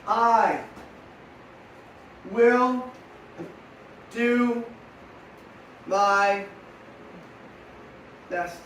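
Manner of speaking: each word spaced out for writing; measured dictation with long pauses; slow dictation. Shown as a demonstration. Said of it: "Ready to write it down?".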